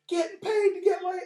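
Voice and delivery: high-pitched mock scream; imitated screaming. People leaving the conference screaming it, high mock scream. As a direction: squeal it like an excited crowd. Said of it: "and they're just screaming it".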